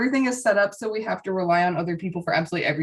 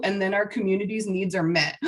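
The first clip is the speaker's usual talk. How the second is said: ordinary talk, nothing marked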